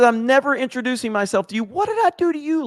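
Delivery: high, indignant read-out; indignant protest. Acted. Streamer answering a viewer who snubbed him, indignant. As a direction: pitch it way up, affronted. Mock-hurt at teasing viewer.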